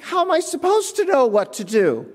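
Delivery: high, exasperated question; mock-petulant employee; indignant whine. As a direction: throw the question back in disbelief. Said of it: "It's like".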